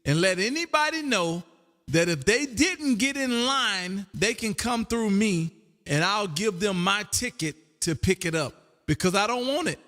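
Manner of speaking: ordinary delivery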